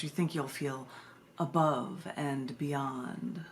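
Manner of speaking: measured, solemn poetry recital with long pauses; poem reading. Acted. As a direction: read it like a spoken-word piece, slowing on key words. Reads second poem aloud.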